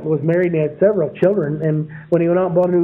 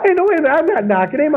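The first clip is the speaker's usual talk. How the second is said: laughing; 10 semitones higher, faster than usual. Real, not acted. Laughing while telling story.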